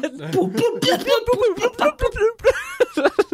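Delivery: high squealing laugh; laughing hard. Real, not acted. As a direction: burst out laughing. Laughing at goofy music.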